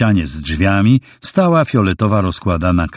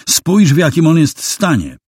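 shocked exclamation; 10 semitones higher than usual. A narrator's reading. Rooney shocked at the corpse.

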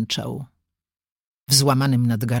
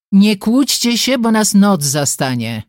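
raised, louder brotherly rebuke; cutting off squabbling; 6 semitones higher, 5 dB louder than usual. A narrator's reading. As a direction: break up the quarrel loudly. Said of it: "Bronek z miejsca uciął dziewczęcy jazgot". (głośne ucięcie kłótni)

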